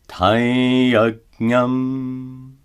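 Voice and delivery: Taiyajnam chanted with a nasalized ya; Sanskrit example. Shown as a demonstration. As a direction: sing Taiyajnam with the ya through the nose. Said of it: "Notice that the anuswara is pronounced as a nasalized ya".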